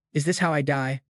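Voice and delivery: ordinary delivery, nothing marked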